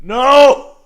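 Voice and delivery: loud, high cry of protest; dismayed shout. Real, not acted. Fan reacting to the game, dismayed shout.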